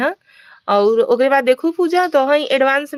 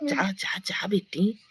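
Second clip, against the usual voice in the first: whisper; 4 semitones lower, 11 dB quieter, slower than usual. Real, not acted. Teasing whisper to girl.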